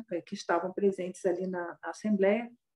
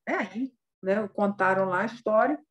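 usual speaking voice

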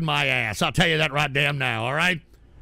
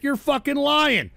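angry accusation; 7 semitones higher than usual. Real, not acted. Accusing a chatter's TTS message of lying, angrily. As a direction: call him a liar, heated.

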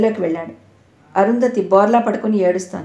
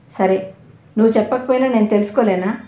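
ordinary delivery

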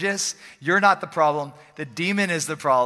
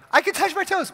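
1 excited churchgoer; 12 semitones higher than usual. Retold or imitated. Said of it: "You'll be at church and like".

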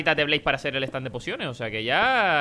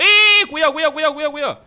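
higher, louder cries of cuidado; alarmed warning shout; 9 semitones higher, 7 dB louder than usual. Real, not acted. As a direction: yell the warning before the spider bites. Spider attack, warning teammates.

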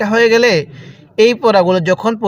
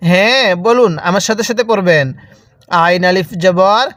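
usual speaking voice

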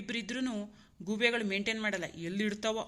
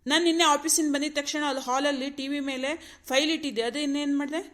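loud, accusing demand; irritated snapping; 3 semitones higher, 4 dB louder than usual. Acted. Husband demands where wife put his file, irritated. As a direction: interrogate her crossly with raised voice. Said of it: "ಅದಕ್ಕೆ ಇಷ್ಟೊಂದು ರೇಗಾಡ್ತಾರ".

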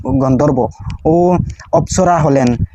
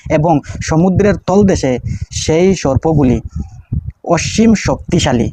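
prayer recitation; 3 semitones higher, slower than usual. Shown as a demonstration.

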